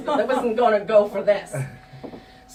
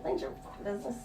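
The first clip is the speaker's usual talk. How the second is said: snide retort; 13 dB quieter, much faster than usual. Retold or imitated. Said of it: "the girl just turned at me and was like".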